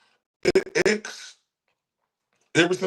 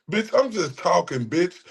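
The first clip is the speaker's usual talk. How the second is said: ordinary talk, nothing marked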